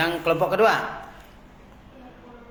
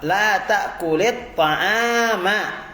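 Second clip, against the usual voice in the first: slow model reading; much slower than usual. Shown as a demonstration. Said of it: "teacher models slow phrase".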